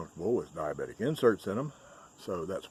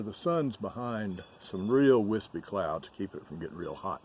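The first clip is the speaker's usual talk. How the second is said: ordinary delivery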